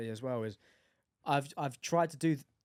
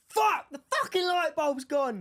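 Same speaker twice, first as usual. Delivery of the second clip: shouted high and loud, slow, full frustration; furious shout; 13 semitones higher, 7 dB louder, slower than usual. Shown as a demonstration. Asked by his co-host to say it for real, a furious yell. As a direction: yell it like the bulb just died on you. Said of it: "say it how you would say it, [name]".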